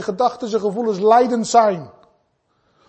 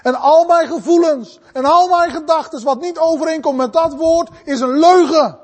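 emphatic, raised sermon delivery; impassioned preaching; 4 semitones higher than usual. Real, not acted. Preacher urging brothers and sisters, impassioned. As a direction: preach it out with conviction.

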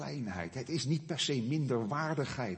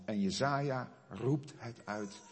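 usual speaking voice

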